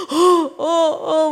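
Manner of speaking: gasping, choked sobs of a weeping mother; imitating sobbing. Mother breaking into tears in counseling, mock gasping sobs. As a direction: gasp and sob out broken syllables. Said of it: "막 이러면서 막 그 자리에서 오열하시면서 우세요".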